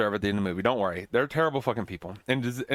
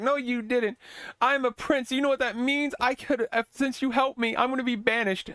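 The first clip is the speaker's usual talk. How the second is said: much higher, petulant mocking prince voice; whiny prince; 12 semitones higher than usual. Prince mockingly scolds the woman who saved him, whiny. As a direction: sneer at her like a spoiled brat.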